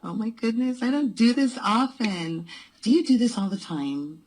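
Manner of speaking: ordinary delivery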